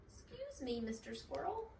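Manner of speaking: very high, timid little squeak; a tiny bunny tugging at a paw; small bunny squeak. A narrator's reading. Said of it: "squeaked the bunny".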